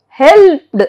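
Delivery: "helped" as one syllable, no vowel before final consonant; silent E. Shown as a demonstration. Teacher shows E D endings, "helped" with silent E. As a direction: run "helped" straight from p into the ending. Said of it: "E is not".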